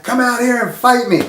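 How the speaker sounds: high mock-angry dare; imitating a pissed challenge. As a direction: throw down the challenge in a put-on voice. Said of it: "now he's pissed".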